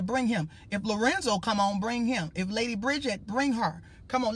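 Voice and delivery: normal talking voice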